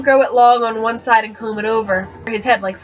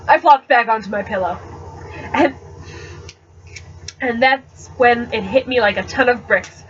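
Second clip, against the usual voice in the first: plain reading voice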